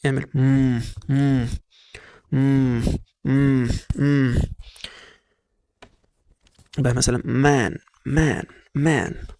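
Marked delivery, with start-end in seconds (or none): 0.3-4.4 مم as a held hum on M; closed-mouth M. Shown as a demonstration. Teacher showing how M sounds, held closed-lip hum. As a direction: hum مم with the lips shut. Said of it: "ما تفتحش عنك".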